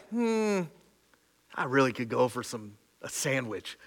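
low, soft idle wish; idle mild craving. Acted. Preacher showing mild grumbling, not wailing, low and soft. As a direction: murmur it casually, low and soft.

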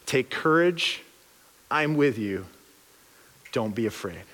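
put-on calm a frightened child; soft, low comforting promise; gentle God's reassurance. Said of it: "He's like".

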